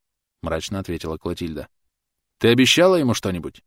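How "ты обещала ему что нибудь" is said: louder, higher desperate demand; almost shout the question; near shout. A narrator's reading. Thomas confronting Clotilde, almost shouting. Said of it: "Он почти кричал".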